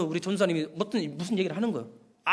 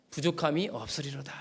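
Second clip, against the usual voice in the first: "부족함이 없으리로다" repeated softer, as an echo; 6 semitones lower, 6 dB quieter than usual. Shown as a demonstration. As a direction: let "부족함이 없으리로다" fall to a quiet reflective repeat. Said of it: "내가 부족함이 없으리로다". ("부족함이 없으리로다"를 조용히 되뇌듯)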